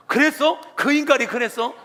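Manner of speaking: high, loud indignant outburst; fuming up. Acted. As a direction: flare up in outrage. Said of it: "더 열받아가지고". (더 열받아서 따지는 말투)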